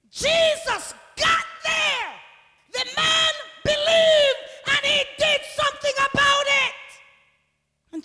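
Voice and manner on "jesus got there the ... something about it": high, sustained pulpit shout; full-force preaching. Real, not acted. Preacher announcing Jesus' arrival to the church, shouted proclamation. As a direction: proclaim it at the top of your voice.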